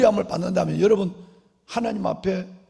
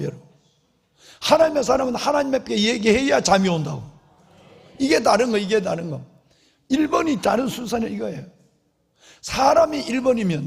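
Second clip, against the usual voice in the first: usual speaking voice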